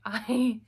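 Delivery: high loud laugh; loud burst of laughter. Real, not acted. Refusing nursing school to viewers, loud laughing burst.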